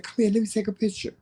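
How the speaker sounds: high, quick coaxing voice; coaxing a child. Retold or imitated. Asking a little boy for a picture, coaxing. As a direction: re-enact beckoning a little one over.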